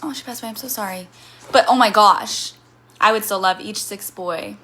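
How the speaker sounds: usual speaking voice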